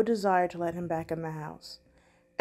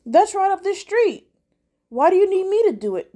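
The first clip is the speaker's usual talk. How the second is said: high, loud, fast suspicious question; suspicious protest; 11 semitones higher, 6 dB louder than usual. Acted. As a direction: fire back the doubt, pitched up. Derek suspicious, objects.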